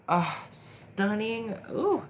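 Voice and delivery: high, slow delighted gasp then a squeal; delighted high-pitched squeal; excited gasp. Real, not acted. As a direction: gush over the eyeliner wing. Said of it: "I'm getting a little excited".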